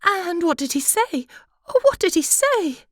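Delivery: high, light wife's voice; high pitched fussy little wife; butterfly wife. Acted. Wife asks eagerly.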